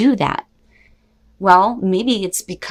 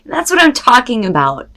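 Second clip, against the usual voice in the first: higher and louder, pumped up; excited cheer; 6 semitones higher, 5 dB louder than usual. Real, not acted. Tarot reader cheering a card she loves. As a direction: celebrate the card out loud.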